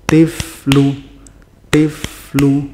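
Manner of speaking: "Tiflu" with the f held one beat, flowing into lu. Shown as a demonstration. Teacher models rikhwah, sustained f in "Tiflu". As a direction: stretch the f in "Tiflu" continuously. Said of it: "suara yang tetap mengalir".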